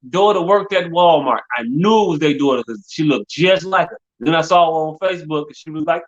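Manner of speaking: ordinary talk, nothing marked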